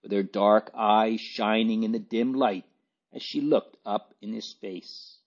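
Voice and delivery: usual speaking voice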